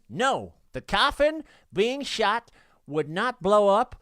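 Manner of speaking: ordinary talk, nothing marked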